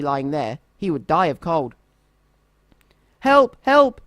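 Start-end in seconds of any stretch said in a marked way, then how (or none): 3.2-4.0 high, loud call for help; shouting for help. A narrator's reading. Dormouse calls for help over the sick donkey, loud high call. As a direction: call out across the snowy wood. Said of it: "he shouted once more".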